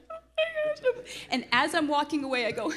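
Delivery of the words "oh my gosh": much higher, aghast squeak; mock dismay. Wife seeing the filthy house, high mock dismay. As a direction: squeal in retold horror. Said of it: "I'm like".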